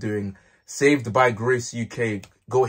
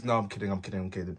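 softer, sheepish backing off; quiet taking it back; 4 semitones lower, 10 dB quieter, much faster than usual. Real, not acted. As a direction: drop it low, laughing it off. Backing off the joke.

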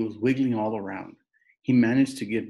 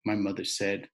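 usual speaking voice

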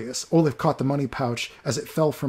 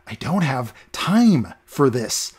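slow, louder menacing growl; 4 dB louder, much slower than usual. Acted. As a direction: growl it slowly through your teeth. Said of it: "Olaf growled".

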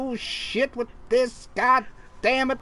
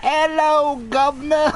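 a hint of cockney greeting; hammed-up British hello; mock British; 3 semitones higher, 3 dB louder than usual. Player answering the British question in mock cockney. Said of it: "Was Bill Cosby actually British?".

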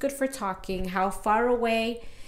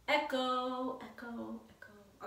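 fading echo; 3 semitones higher, 12 dB quieter, much slower than usual. Shown as a demonstration. Vlogger testing bathroom for echo, fading calls. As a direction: call echo, fading away to almost nothing.

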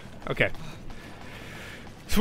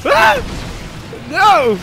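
panicked high yelling; being chased; 8 semitones higher, 3 dB louder, slower than usual. Real, not acted. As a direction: scream for help while fleeing. Fleeing monster in panic.

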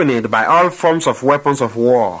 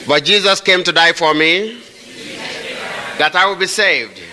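preaching at full force; 5 semitones higher than usual. Acted. Preacher leading the congregation against the devil, full-force proclamation. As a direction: proclaim it like a battle cry to the devil.

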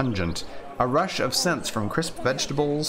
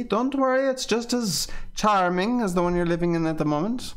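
high, bright reassurance; reassuring in a raised voice; reassuring Armand; 8 semitones higher than usual. Acted. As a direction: soothe her worry lightly.

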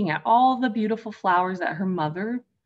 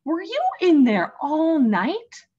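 crying out; 8 semitones higher, 5 dB louder than usual. Acted. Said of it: "cried her mother".

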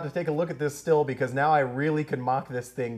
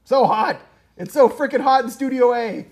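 exasperated shout; 8 semitones higher, 9 dB louder than usual. Real, not acted. Complaining about studio heat.

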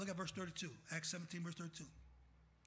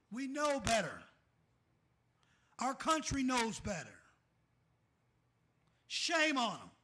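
loud, higher preaching; full-force preaching; 5 semitones higher, 10 dB louder than usual. Acted. Pastor scolding the country before his church, loud full-force preaching. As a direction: thunder it at the congregation.

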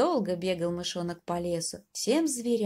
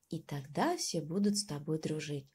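plain reading voice

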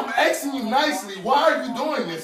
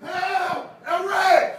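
calling for help; 7 semitones higher, 4 dB louder, much slower than usual. Real, not acted. Detained man calls friend for help.

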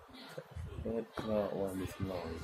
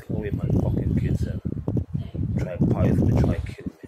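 loud furious outburst; angry shouting; 7 dB louder than usual. Real, not acted. Man ranting about racism to camera, shouting. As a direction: hurl it out, fed up.

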